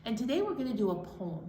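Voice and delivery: plain reading voice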